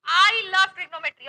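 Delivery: slow, loud, every word drawn out; slow emphatic slogan. Shown as a demonstration. Teacher leading students in a slogan, slow and loud. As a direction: say it like a proud class chant. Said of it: "teacher models class slogan".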